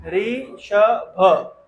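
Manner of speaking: ऋषभ broken into slow, separate syllables, री-श-भ; ऋषभ in loud, drawn-out dictation syllables. Shown as a demonstration. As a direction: sound out ऋषभ very slowly and firmly for spelling. Teacher sounding out spelling.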